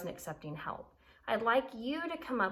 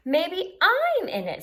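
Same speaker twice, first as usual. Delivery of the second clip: excited jellyfish; 9 semitones higher, 9 dB louder, slower than usual. Acted. Jellyfish voiced excitedly in story.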